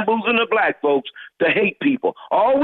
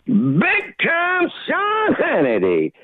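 drawn-out shout, pitched up; shouted greeting; 6 semitones higher, much slower than usual. Acted. Caller hails the radio host, shouted slow and high.

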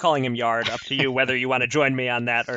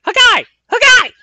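shrill, much higher yelled name, twice; high-pitched yelp; 12 dB louder than usual. Retold or imitated. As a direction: yelp the name like a punch grunt. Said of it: "sound like he was yelling his own last name".

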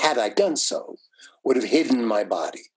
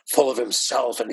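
ordinary talk, nothing marked

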